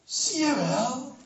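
high, slow, gloating taunt; gloating demon. Acted. Demons gloat over Jesus, high slow mock. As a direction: savor the triumph. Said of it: "juichte de engelen scharen".

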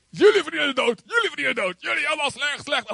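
hammed-up high-pitched self-righteous accusing; self-righteous condemnation. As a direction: point the finger at everyone. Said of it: "Oftewel dat je zegt".

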